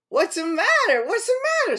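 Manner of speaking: loud, high-pitched fussing; eager fox questioning. A narrator's reading. Fox quizzes the unhappy bear, loud and high. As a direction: ask it loud, jumping up high.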